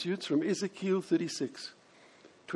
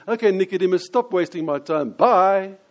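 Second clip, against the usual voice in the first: loud, higher-pitched brush-off ending on a big "bye"; brusque dismissal; 3 semitones higher, 9 dB louder than usual. Acted. Preacher acting a Jesus who dismisses Nicodemus, curt and loud. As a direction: send him packing loudly. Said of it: "if he was being dismissive".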